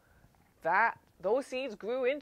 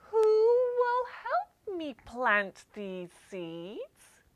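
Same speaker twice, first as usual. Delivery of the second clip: hen asking for help; 9 semitones higher, 4 dB louder, much slower than usual. Acted. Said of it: "she asked".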